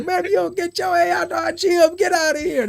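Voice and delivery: high-pitched coach throwing someone out; angry coach. Retold or imitated. Coach kicking a player out of the gym, angry. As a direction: voice way up, hammed-up outraged ejection. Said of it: "Coach Berger".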